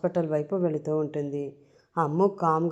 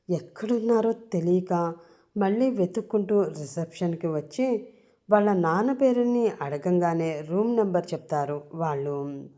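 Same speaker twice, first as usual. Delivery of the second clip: ordinary delivery, nothing marked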